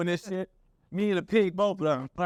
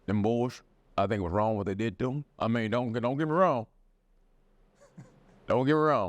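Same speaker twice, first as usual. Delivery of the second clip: low, country-quartet bass voice; deep Southern baritone; 7 semitones lower than usual. Acted. Deep-voiced Southerner admitting they were wronged, deep baritone. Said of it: "when they have that deep baritone".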